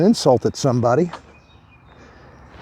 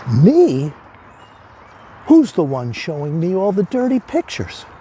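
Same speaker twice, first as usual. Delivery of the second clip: much higher, offended disbelief; indignant surprise; 7 semitones higher than usual. Acted. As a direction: bounce the blame back, incredulous. Man protests psychiatrist's verdict.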